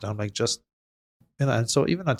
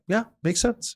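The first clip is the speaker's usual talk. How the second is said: mock agreeable; 12 semitones higher, slower than usual. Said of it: "I'm like".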